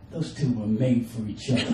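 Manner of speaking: dry, sarcastic low take. Retold or imitated. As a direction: say it with a sneer. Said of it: "take away the tone of it".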